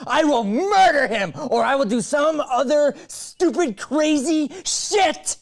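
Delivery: high, loud and manic; unhinged ranting shout. Shown as a demonstration. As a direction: rant at him like she's lost it, laughing wildly. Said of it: "I know that was crazy and weird".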